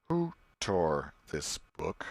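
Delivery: slow, heavy, menacing teacher's question; wrathful schoolmaster. Acted. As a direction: let the anger sit in every word. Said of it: "The master was gathering his wrath".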